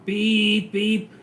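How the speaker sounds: mock car horn, high and loud; horn beeps. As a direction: honk it like an impatient driver. Imitating car horn in story.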